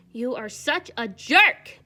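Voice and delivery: loud, slow playful scold; mock outrage. Real, not acted. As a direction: pretend to be offended.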